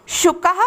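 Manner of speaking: शुकः with a light h after the ka; teacher demo of शुकः; Sanskrit pronunciation. Shown as a demonstration. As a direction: end शुकः on a breathy h. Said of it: "मेरे साथ आप भी उच्चारण करिए".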